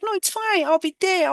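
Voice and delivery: high, light imitation of a friend's easy yes; breezy reassurance. Friend reassuring host she'll come all the way, high mock voice. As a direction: mimic her casual no-problem reply, pitched well up. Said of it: "But she was like".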